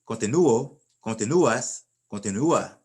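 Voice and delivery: Continuo, continúas, continúa with a loud stressed NU. Shown as a demonstration. Teacher drilling where the accent mark falls, stressed u. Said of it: "your accent mark's on you".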